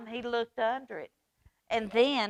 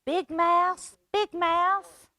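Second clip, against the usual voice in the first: high, louder little voice; Little Mouse calling; 9 semitones higher, 5 dB louder, slower than usual. Acted. Little Mouse calling his brother at night, high and loud. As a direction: call out small and urgent. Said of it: "called Little Mouse".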